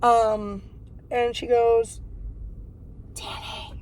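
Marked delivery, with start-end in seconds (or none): 3.1-3.7 imitating mom's hushed, secretive whisper. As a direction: whisper it as if someone might overhear. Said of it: "She's whispering".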